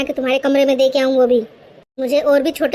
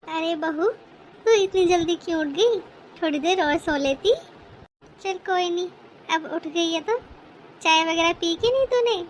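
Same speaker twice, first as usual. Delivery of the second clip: put-on a touch higher, fond elderly mother-in-law; doting mother-in-law; 5 semitones higher, 4 dB quieter than usual. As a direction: bless the young bride like a pleased saas. Mother-in-law greets daughter-in-law. (हल्की सी ऊँची, लाड़ भरी सास की आवाज़)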